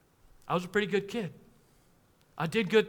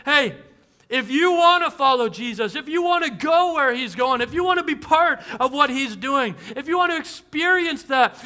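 raised, higher preacher's call; calling the room forward with a raised voice; retold speaker's call; 5 semitones higher, 4 dB louder than usual. Retreat speaker calling teens to the front, loud and high. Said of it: "he yells out".

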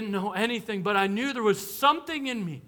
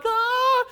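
crying wail; 5 dB louder than usual. Retold or imitated. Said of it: "I'm just like".

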